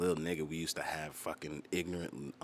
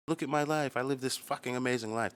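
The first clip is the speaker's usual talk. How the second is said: mock show-off; 8 semitones higher, 3 dB louder than usual. Mocking a show-off on Instagram.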